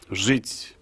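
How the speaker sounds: normal talking voice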